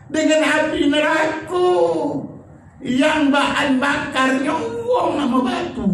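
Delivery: high, slow and forceful sermon voice; rising preacher's warning; fiery preaching. Acted. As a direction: warn the congregation of hellfire. Preaching about hellfire.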